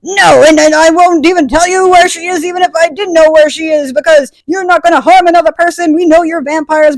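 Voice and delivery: high, loud mock refusal; indignant grandmother. Grandmother refuses to help the vampires, loud mock indignation. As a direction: refuse loudly, voicing an outraged grandmother.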